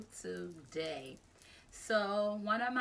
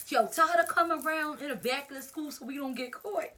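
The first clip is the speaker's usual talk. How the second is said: higher, louder, pushing it out; acted-out shouty bravado; retold bold instruction; 6 semitones higher, 5 dB louder than usual. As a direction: call out orders to the crowd. Calling out fight instructions.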